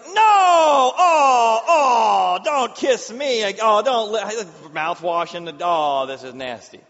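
loud, high shrieking disgust; horrified shout. Retold or imitated. Owner begs the dog not to kiss, loud horrified shriek.

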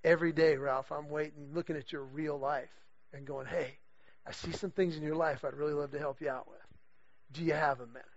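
low, hushed voice, still voiced; imitated soft whisper. God offers a struggling golfer help with his life, softly confiding. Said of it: "God just kinda whispered in my ear".

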